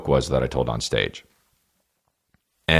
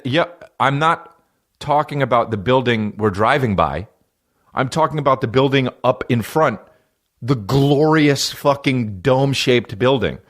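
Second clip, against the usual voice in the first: raised-pitch mock exasperation, spelled out; retold fiery exasperation; 6 semitones higher than usual. Passenger correcting the driver, retold exasperation. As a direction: re-enact losing patience, pitch up. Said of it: "this shit made me fiery red mad".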